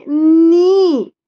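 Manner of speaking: "नी" slow, with a long held ee; stretched ee. Shown as a demonstration. Mother shows how mama says it, long ee. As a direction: draw out "नी" as a teaching model. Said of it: "कैसे बोल रही मम्मा".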